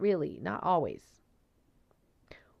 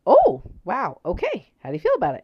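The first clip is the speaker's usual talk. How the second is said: surprised; 5 semitones higher, 12 dB louder than usual. Retold or imitated. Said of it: "I was like".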